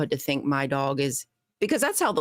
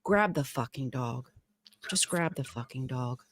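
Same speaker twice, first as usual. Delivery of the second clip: low, softer exasperated mutter; muttered aside; 6 semitones lower, 7 dB quieter than usual. Real, not acted. Fed-up dog walker muttering about the neighbor, much softer. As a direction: grumble it under your breath. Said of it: "muttered frustration".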